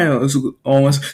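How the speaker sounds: plain reading voice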